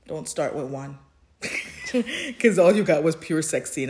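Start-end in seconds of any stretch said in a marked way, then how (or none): 1.4-2.3 high laugh. Real, not acted. Laughing at own story.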